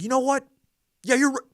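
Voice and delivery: high, loud retold comeback; imitated indignant lady. Lady answering the soldier's tax joke, imitated indignation.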